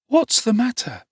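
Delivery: put-on a startled boy demanding to know. A narrator's reading. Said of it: "demanded Oliver".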